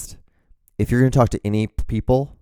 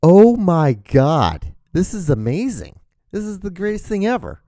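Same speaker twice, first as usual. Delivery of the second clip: mock awe; 8 semitones higher, 3 dB louder than usual. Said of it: "just be like".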